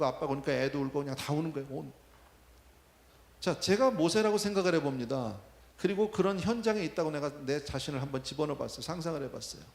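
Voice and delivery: ordinary talk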